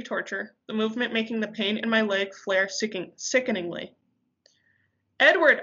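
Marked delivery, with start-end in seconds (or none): 5.2-5.6 high, loud scream of his name. A narrator's reading. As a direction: scream out for him in pain. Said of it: "I screamed".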